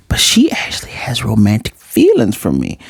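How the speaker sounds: hushed inner-thought whisper. Retold or imitated. His inner thought revealed.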